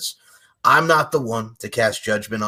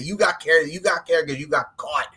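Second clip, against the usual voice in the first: angry rant at killer; 5 semitones higher, 4 dB louder than usual. Retold or imitated. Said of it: "and I'm like".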